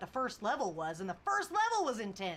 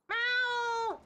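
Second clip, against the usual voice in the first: high, loud imitated cat meow; 13 semitones higher, 6 dB louder than usual. Shown as a demonstration. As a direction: call out to the cat like a cat. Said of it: "Every time I meow".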